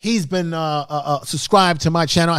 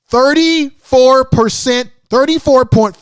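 excited announcing; 7 semitones higher, 7 dB louder, slower than usual. Real, not acted. Showing his channel analytics.

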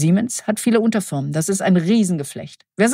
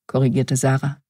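plain reading voice

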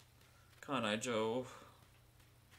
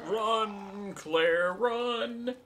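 urgent shout; 8 semitones higher, 7 dB louder, much slower than usual. Real, not acted. Urging character to flee.

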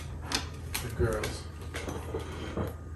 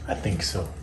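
much softer, lower repeat of "I think so"; quiet echo; 5 semitones lower, 9 dB quieter than usual. Real, not acted. Owner softly agreeing with the dog after lunch, quiet echo. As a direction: drop it to a soft murmur.

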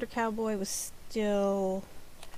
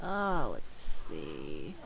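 low drawn-out musing; much slower than usual. Real, not acted. Idly checking streams.

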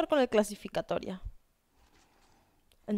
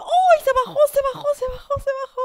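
loud, very high match-caller yell; startled high-pitched commentary cry; excited outcry; 14 semitones higher, 7 dB louder, faster than usual. Real, not acted. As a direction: burst out shrieking, repeating it urgently. Sudden drop in live match.

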